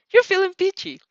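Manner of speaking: high bright giddy line. Acted. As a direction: gush it out happily. Narrator gushing, high and loud.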